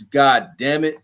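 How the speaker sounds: ordinary talk, nothing marked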